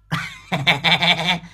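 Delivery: high, rapid staccato cackle; cackling laugh. Real, not acted. Laughs after own rant. (cười khanh khách giọng cao)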